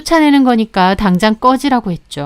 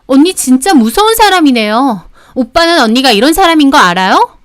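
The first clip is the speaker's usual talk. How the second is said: higher-pitched, affronted protest; indignant sister-in-law; 5 semitones higher than usual. Acted. Sister-in-law challenging her brother's wife, indignant. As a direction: push back at being told off, voice raised in pitch. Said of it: "얼굴이 발갛게 달아올라서".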